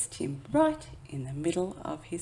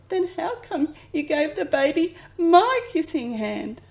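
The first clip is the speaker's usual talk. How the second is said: put-on upset child; 10 semitones higher, 9 dB louder than usual. A narrator's reading. Upset child presses mother.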